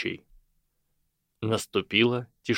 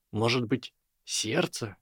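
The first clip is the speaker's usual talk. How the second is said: usual speaking voice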